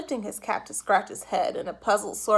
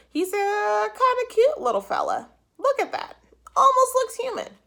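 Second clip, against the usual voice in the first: high, loud, delighted cop voice; charmed policeman; 12 semitones higher, 6 dB louder than usual. A narrator's reading. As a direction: coo over the cute penguin. Policeman admiring penguin.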